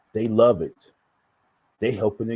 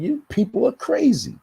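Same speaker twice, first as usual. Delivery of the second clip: high, incredulous dismissal; exasperated; 13 semitones higher, 4 dB louder than usual. Retold or imitated. Remembering online trolls, a high incredulous scoff. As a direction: toss it off as if they've lost their minds. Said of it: "That's why I was like".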